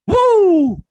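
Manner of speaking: high, loud Ric Flair woo; wrestler's signature woo. Retold or imitated. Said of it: "like Ric Flair".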